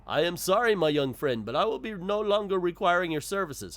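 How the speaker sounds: a light character voice for a formal firing; put-on shopkeeper voice. Shopkeeper dismissing his young worker, lightly put-on voice. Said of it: "I did a voice".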